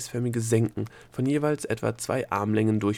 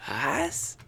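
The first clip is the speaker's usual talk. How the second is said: higher, dumbfounded question; aghast; 5 semitones higher than usual. A narrator's reading. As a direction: ask it utterly taken aback. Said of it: "fragte Opal entgeistert".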